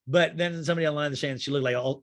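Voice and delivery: ordinary talk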